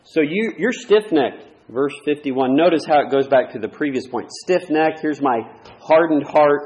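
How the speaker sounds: loud pulpit reading of scripture; emphatic preaching. Acted. Preacher reading Stephen's rebuke to the congregation, forceful preaching. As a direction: press the verses home, loud and firm.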